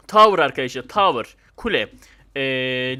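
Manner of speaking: ordinary talk, nothing marked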